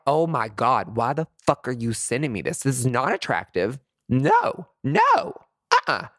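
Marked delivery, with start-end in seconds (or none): 4.1-6.1 high, loud repulsed no; shrieking no, much higher and louder; disgusted no. Real, not acted. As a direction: recoil in disgust. Reacting in disgust.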